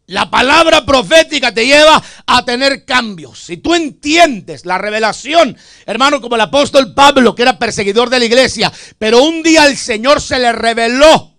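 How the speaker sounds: loud fiery sermon, rising to a call; preaching at full force. Acted. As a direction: preach it hard, demanding a glory to God. Preaching climax to congregation.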